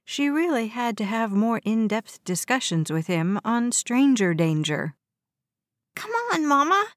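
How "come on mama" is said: put-on very high, urging child's voice; pleading little boy. A narrator's reading. West tugs his mother's sleeve, high childish urging. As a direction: coax Mama as an eager small boy.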